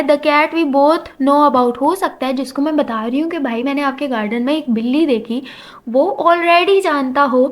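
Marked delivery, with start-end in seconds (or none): 0.0-1.8 slow emphatic. Shown as a demonstration. Stressing the example meaning.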